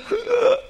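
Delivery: sharp, high intake of breath; re-enacted shocked gasp; imitated startled gasp. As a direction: gasp as if stopped short. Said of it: "I saw her doing".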